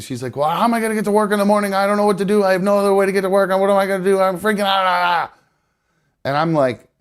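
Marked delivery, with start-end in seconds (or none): 0.3-5.4 high, rapid-fire hammed-up freak-out; mock panic. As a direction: imitate someone spiralling, rushing into babble. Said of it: "immediately starts to panic".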